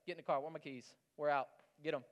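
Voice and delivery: ordinary talk, nothing marked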